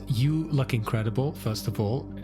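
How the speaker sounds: ordinary talk, nothing marked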